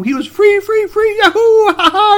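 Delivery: loud, very high whooping glee; jubilant. A narrator's reading. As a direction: squeal with the joy of freedom. Story peaks in joyful escape.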